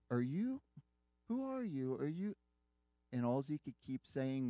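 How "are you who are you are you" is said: high, rising, halting questions; retold astonished questioning. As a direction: voice baffled rescuers, pitch jumping up. Rescuers astonished at the found boy.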